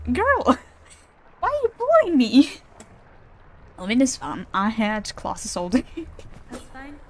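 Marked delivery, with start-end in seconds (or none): 0.1-2.5 much higher, loud laughing whine; mock-hurt, half laughing; laughing complaint. Real, not acted. Player whining at the boss bullying her, laughing.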